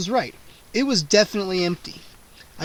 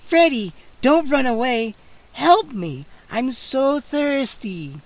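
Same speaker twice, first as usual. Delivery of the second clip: louder, drawn-out hungry coaxing; coaxing vampire; 7 semitones higher, 4 dB louder, slower than usual. A narrator's reading. Scene: cornered Freddy, the vampire coaxes him hungrily. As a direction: lure him in slowly.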